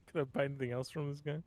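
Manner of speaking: ordinary talk, nothing marked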